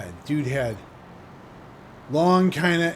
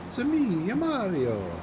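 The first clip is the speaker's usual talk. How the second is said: half-baked Italian plumber voice, much higher; Mario; 7 semitones higher than usual. Retold or imitated. Streamer tossing off a half-baked video game plumber impression. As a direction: toss off a quick, high cartoon plumber catchphrase. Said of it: "Yeah, half-baked Mario".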